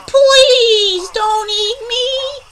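very high, slow, loud fake begging; mocking plea. Otter mocks toothless gator, fake pleading. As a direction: drag out "please" in squeaky pretend terror. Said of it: "he mocks".

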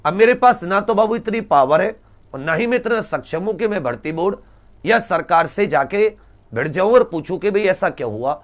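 ordinary talk, nothing marked

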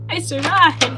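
triumphant shout after a near miss; excited cheer. Real, not acted. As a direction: celebrate the escape out loud. Gamer escapes a close call.

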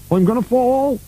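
high-pitched panicky character voice; alarmed cry. A narrator's reading. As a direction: cry out as you lose your footing. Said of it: "as he felt himself slipping".

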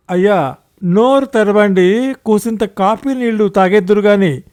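high, loud coaxing call; urgent servant's plea at full voice; loud shouting. A narrator's reading. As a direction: shout to rouse an unresponsive master. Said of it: "గట్టిగా అరుస్తూ చెప్తున్నాడు".

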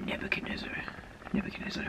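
barely voiced whisper; muttering to himself. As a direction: mutter the name while puzzling over it. Acting archbishop puzzling over a name.

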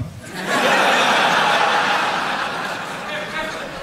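long, voiceless wheezing laugh; real laugh; breathy laugh. Real, not acted. Audience-facing laugh.

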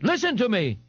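high-pitched demand for attention; pompous cry to a crowd; cried. A narrator's reading. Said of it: "Ignatius cried".

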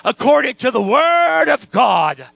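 loud, drawn-out proclamation; slow, ringing pulpit cry; preaching climax. Real, not acted. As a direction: stretch it out in a preacher's shout.

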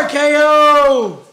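loud, high, stretched-out battle cry; wrestling move call. Pretend. Play-wrestler slamming a friend on the bed, drawn-out shout. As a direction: yell it long like a finishing move.

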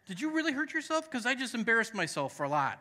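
high, disbelieving questions to her daughter; incredulous questioning. Retold or imitated. Father questioning his daughter, high and disbelieving. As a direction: ask it like you can't believe it. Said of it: "I even questioned her, like".

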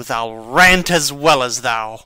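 loud graveside rant. Acted. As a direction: hurl the words like a challenge. Hamlet ranting at Laertes over grave.

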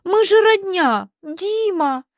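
high-pitched protesting aunt; acted dismayed appeal; fussing, flustered aunt. A narrator's reading. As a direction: plead kinship in a fluster. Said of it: "засуетилась Тамара Александровна".

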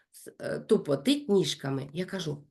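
normal talking voice

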